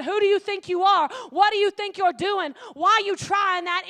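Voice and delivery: loud, mocking challenge; loud mocking critics jeering; scoffing doubters. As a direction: throw their doubt back loud. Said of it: "people saying".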